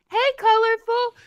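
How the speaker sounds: high, loud, chummy hello; super-friendly greeting. Retold or imitated. Imitating the pizza manager greeting a regular, loudly friendly. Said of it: "super fucking friendly".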